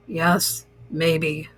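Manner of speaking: low, soft, unhurried turtle; lightly voiced old turtle. Turtle musing to Toad, lightly voiced, low and soft. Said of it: "said the turtle".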